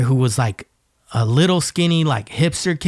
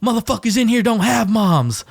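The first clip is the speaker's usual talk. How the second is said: higher, scornful retort; tough inmate retort; 8 semitones higher than usual. Retold or imitated. Inmates answering the crying kid, higher scornful retort. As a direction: shoot it back like a hardened inmate. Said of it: "they'd be like".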